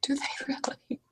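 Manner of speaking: breathless, hushed disbelief; surprised whisper. Acted. A woman hears about the mermaids and whispers in surprise. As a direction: whisper it like shocking gossip.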